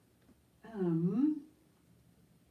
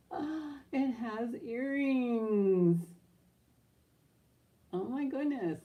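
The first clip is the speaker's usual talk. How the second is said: squealing high with delight; thrilled, slowed-down gasp of joy; excited; 8 semitones higher, 5 dB louder, slower than usual. Real, not acted. Delighted to find matching earrings.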